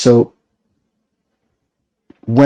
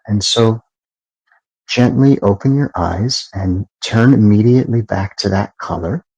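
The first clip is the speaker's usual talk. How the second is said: slightly softer, unhurried meditation guidance with long pauses; soft guiding voice. Acted. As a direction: ease them gently out of the breathing exercise. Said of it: "the difference in the tone of my voice".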